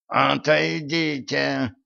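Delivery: high, slow, strained croak; hoarse croak. A narrator's reading. Captive prince orders his people back, croaking hoarsely. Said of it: "прохрипел князь".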